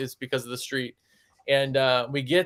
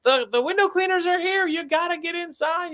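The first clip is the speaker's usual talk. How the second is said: mock yelling nurse. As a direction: yell up to him like the nurse. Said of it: "she's yelling at him".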